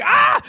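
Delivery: very high loud mock shriek; imitated meltdown scream. Dad imitating his son's meltdown, loud and very high. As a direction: shriek like a kid losing it.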